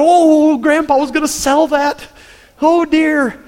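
high, loud panicked cry; panicking. Retold or imitated. Boy sees the dog grab grandpa's rooster, panicked cry. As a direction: blurt it out in sudden panic. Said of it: "I am panicking".

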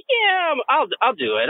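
mock the cowardly passenger as a jumpy little chicken. Narrator mocking a timid seatmate as Chicken Little, high squeak. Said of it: "Chicken Little over there may say".

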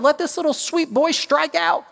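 a coach's desperate plea from the dugout; urgent prayer. Retold or imitated. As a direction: beg the Lord for the strikeout. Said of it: "I'm praying to the Lord".

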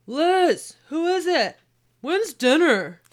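loud, high, bellowing demand; husband bellowing. Acted. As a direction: bellow it from the couch. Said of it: "more bellowed".

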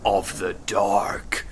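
spooky voice. Acted. Spooky show-intro question.

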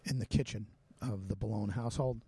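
ordinary talk, nothing marked

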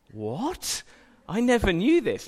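mock shock of a seven-year-old; amazed child.

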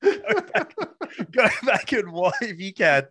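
laughing plea to go back and watch. Real, not acted. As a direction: laugh while urging him to rewind. Laughing urging friend.

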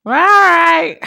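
mock wifely reply. Jokingly voices the wife's reply.

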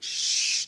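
whispered hush; whispered shh; finger-to-lips shush. Retold or imitated. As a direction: hush him like a dog. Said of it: "put my finger against his lips and be like".

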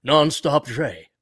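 drawn-out mock-British greeting; British clubman's hello, loud at the end. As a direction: greet him in a hammed-up English accent. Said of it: "none of us have British accents".

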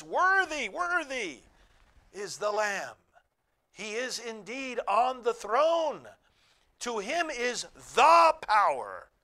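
loud worshipful proclamation; ringing shout of praise. Acted. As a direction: proclaim it like the whole heavenly council crying out. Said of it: "They are shouting in a loud voice".

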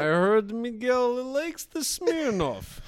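put-on high, slow Russian accent slipping off course; exaggerated Eastern European comic accent; Borat. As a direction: draw it out in a mock foreign accent, Russian gone wrong. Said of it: "I went a little Borat".

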